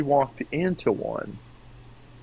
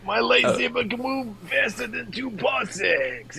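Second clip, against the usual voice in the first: put-on high, slow New York-accented alien; Watto; 9 semitones higher, slower than usual. Alien doubting the Force, hammed-up New York accent. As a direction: drag it out in a hammed-up alien dealer voice. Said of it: "I picture Watto from Star Wars".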